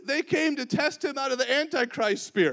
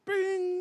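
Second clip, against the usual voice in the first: high ringing ding, held out; high "bing"; 9 semitones higher than usual. Retold or imitated. Jesus grabs hold of that moment, imitated high ding. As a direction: sing out a bell-like bing.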